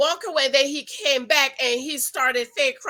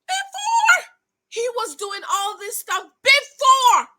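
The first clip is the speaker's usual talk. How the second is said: very high, loud "before, before" dragged out; exasperated before; 10 semitones higher, 5 dB louder, slower than usual. Retold or imitated. Vlogger mocking women who ignored signs, high and exasperated. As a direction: shriek the word "before" in disbelief. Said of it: "And I'm like".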